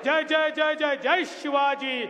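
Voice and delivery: shouted rally chants, slow and loud; roaring rallying speech. Acted. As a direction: lead the crowd in chanting slogans.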